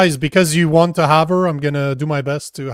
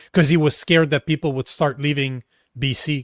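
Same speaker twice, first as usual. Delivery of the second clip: normal talking voice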